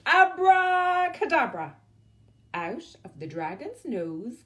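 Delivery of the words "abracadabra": witch's shout. Acted. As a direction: cast the spell with a big call.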